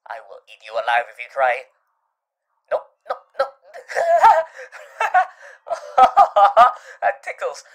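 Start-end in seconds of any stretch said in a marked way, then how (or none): 2.7-7.6 helpless giggling protest; breaking into ticklish laughs; ticklish laughter. Acted. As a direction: laugh through the words as if being tickled. Character is being tickled while protesting.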